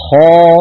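خا with full emphatic open a, no lip rounding; heavy alif. Shown as a demonstration. Teacher models correct emphatic alif. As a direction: say خا pressing the sound up to the palate. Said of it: "فعلينا أن نقول هكذا".

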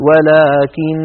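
"ولكن" with the noon nasal drawn out; stretched ghunna on final noon. Shown as a demonstration. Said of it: "لما نقف على ولكن نقول".

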